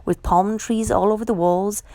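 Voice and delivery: ordinary delivery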